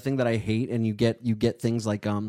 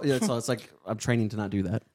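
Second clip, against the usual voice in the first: ordinary delivery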